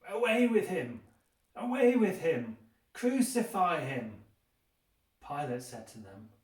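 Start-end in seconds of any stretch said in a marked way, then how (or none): 0.1-4.2 loud, high, furious shouting, slowing on the last cry; a mob howling for blood, each cry higher and louder; crowd crying out. Acted. The crowd shouting at Pilate to crucify Jesus, a furious yell. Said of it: "And they cried out".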